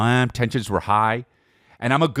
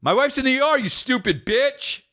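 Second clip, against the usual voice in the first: retold yelled insult; 12 semitones higher than usual. Telling the old lady off a second time, high mocking shout. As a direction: hurl it up high at her. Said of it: "I said".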